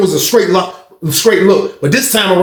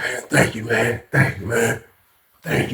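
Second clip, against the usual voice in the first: low, soft retold gratitude; subdued thanks; 9 semitones lower, 9 dB quieter, slower than usual.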